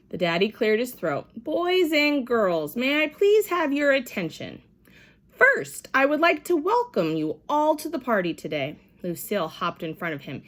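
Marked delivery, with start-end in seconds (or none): 1.4-8.8 formal welcome. A narrator's reading. Daddy addresses party.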